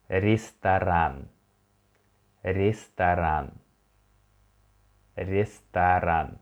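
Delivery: ресторан said slowly as ri-sta-RAN, O read as A; slow Russian model word. Shown as a demonstration. Teacher slowly models ресторан three times for repeating. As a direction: draw out ресторан with stress on the end. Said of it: "The next one".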